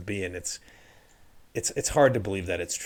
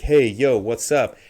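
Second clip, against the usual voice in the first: loud, higher "hey yo" greeting; casual American family; 3 semitones higher, 9 dB louder, slower than usual. Retold or imitated. Imitating the girl's family at home, loud casual greeting.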